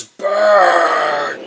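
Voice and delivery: loud, high, roaring half-orc cry; growled battle cry. Acted. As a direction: roar it out as a charging half-orc. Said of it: "You hear a large growl".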